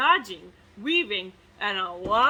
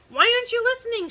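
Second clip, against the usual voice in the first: fast, high, loud exasperated shout; 11 semitones higher, 8 dB louder, much faster than usual. Acted. King shouting at Boy who cannot hear, fast and loud. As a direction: hurl the question at the boy. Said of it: "shouted the king".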